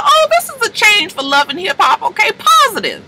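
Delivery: high, mock-gushing surprise; mock amazement. Recapping a reality show, mock gushing amazement. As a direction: gush over the show being positive.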